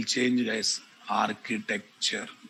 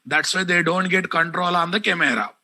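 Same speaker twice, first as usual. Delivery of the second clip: angry scolding; 4 semitones higher than usual. Real, not acted. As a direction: call him out angrily by name. Teacher scolding and calling out student.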